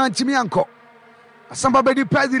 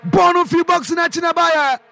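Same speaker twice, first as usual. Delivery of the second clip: commentary shout; 5 semitones higher than usual. Real, not acted. Commentator to listeners as the ball leaves the box, shouting. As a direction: belt it out as the shot flies.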